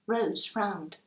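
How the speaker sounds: ordinary delivery, nothing marked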